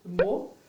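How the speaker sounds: 뭐 stressed, pushed out firmly. Shown as a demonstration. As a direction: lean hard on 뭐. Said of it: "If you emphasize on the word".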